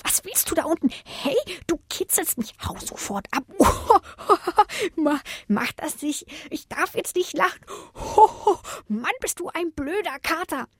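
high voice breaking into giggles; softly squawking, tickled and cross; grumbling ticklish bush. A narrator's reading. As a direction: complain while fighting off laughter. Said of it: "meckerte der Busch".

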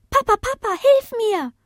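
very high, loud puppy cry for help; high-pitched child-like puppy voice; little one calling. Acted. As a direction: call out urgently as a tiny pup. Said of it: "rief der Kleine".

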